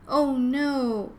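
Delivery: lightly acted sobbing dismay; mock sob. A narrator's reading. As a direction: lament it loudly without real crying. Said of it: "Ava sobbed".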